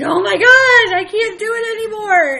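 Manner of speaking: high, loud cry of a mother at her limit; high overwhelmed outburst; exasperated mock scream. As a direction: wail it like you've hit the end. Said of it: "be like".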